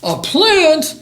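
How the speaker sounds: high, loud shriek of disbelief; mother dinosaur appalled at her baby; horrified yell. A narrator's reading. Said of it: "yelled Mom in horror".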